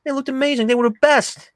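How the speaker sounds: high-pitched mock praise; imitated teasing boast. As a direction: play a loudmouth ribbing someone. Acting Billy's teasing boast.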